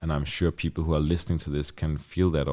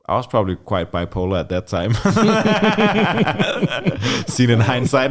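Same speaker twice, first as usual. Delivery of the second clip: real laughter breaking into the words; laughing through it; 8 semitones higher, 9 dB louder than usual. Real, not acted. Laughing as he admits to the host he was bipolar. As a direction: laugh at your own wild past while admitting it. Laughing at his past self.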